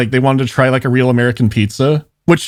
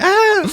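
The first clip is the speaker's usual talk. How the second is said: high mimicked squeal at a sight; delighted scream. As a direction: squeal like an excited child pointing something out. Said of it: "She starts screaming in delight".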